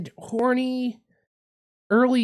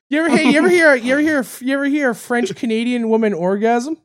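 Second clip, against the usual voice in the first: normal talking voice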